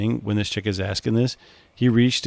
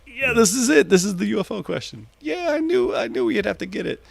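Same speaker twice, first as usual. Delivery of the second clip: giggly colleague; 11 semitones higher than usual. Retold or imitated. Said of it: "he was like".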